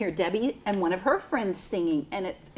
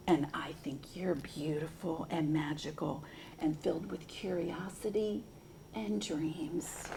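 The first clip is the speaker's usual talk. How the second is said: soft whispered refrain; whispering; 6 semitones lower, 14 dB quieter than usual. Acted. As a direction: whisper it to the children. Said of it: "whispered repeat of the refrain".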